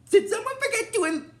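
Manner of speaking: very high-pitched comic character voice; squeaky joking impression; Ninjor. Imitating Ninjor joking with the Rangers, high comic voice. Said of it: "he's like".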